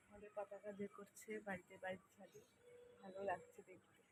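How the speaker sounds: hushed, soft voice so as not to disturb; speaking softly. Real, not acted. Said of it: "আমি এত আস্তে কথা বলছি".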